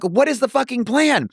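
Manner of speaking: high-pitched mock frantic demand; retold exasperation. Wrestler demanding the new plan mid-beatdown, retold exasperation.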